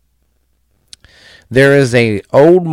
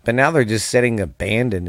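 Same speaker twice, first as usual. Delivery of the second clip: normal talking voice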